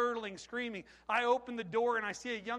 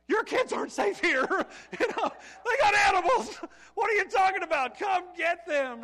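high, very loud comic hysteria; frantic yelling; 10 semitones higher, 12 dB louder than usual. Acted. Worker's inner reply to trusting father, frantic yelling. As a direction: scream it in exasperated panic.

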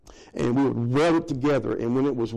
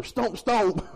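much higher playful shooing; mock shooing; 6 semitones higher than usual. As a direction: shoo it off in a high maid voice. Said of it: "Those maids come through there".

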